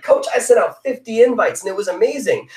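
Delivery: fishing for praise, all fired up; eager hype. Retold or imitated. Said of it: "I'd message my coach and be like".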